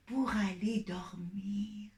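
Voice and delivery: soft slow whisper; chuchoté. Acted. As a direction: whisper it like a bedtime secret. Bedtime thumb advice to child. (voix chuchotée et lente)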